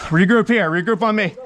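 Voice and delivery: loud rally call; shouted call. Real, not acted. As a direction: call the squad in. Calling squad to regroup.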